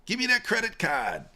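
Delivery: high-pitched Boston mom demand; awful Boston accent. Retold or imitated. Mom wanting her card from her son, high mock Boston accent. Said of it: "Boston accent".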